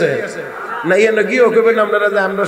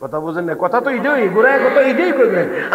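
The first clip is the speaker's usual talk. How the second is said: ordinary talk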